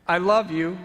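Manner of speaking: impassioned cry. Acted. Jesus to the onlooker on the cross, loud impassioned cry. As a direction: cry it out through the pain. Jesus speaks at second spike.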